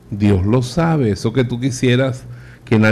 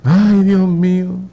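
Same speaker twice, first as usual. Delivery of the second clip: hammed-up cry of despair; anguished miser; 7 semitones higher than usual. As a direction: lament your lost money with a long "ay". Miser agonizes over lost money.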